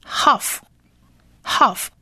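half said slowly, twice with a long pause; half pronounced "hahf". Shown as a demonstration. Teacher revisits the fourth letter, slow half demo. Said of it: "我们这里念".

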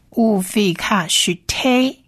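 乌菲卡许t slowly, as "u-fi-kha-sh-te"; 乌菲卡许t as a slow build-up of sounds; syllable-by-syllable demo. Shown as a demonstration. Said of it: "所以是t a t".

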